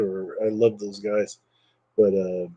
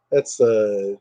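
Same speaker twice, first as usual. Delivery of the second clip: normal talking voice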